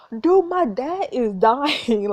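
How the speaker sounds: loud, drawn-out exasperation; exasperated outburst. Real, not acted. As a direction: stretch each word out loudly in disbelief. Exasperated outburst at the game character.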